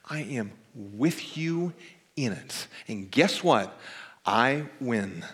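slow, tender words, half whispered in places; Jesus. Retold or imitated. Preacher voicing Jesus to a hurting congregation, slow and tender. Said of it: "he says".

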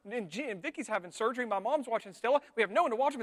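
rapid-fire worry spiral; mock panic-attack inner monologue; panicked rambling. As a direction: rattle it off like racing thoughts. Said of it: "I talk like this in my mind when I'm having a panic attack".

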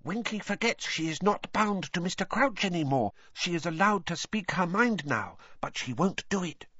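high elf voice, kept down; confiding Dobby elf. Acted. Dobby explains Winky's trouble to Harry, high and confidential. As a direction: confide it as a small squeaky elf.

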